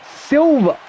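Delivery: very high, put-on squeak; mock squeaky blurt; squeaky silly voice. Blurted word in odd voice.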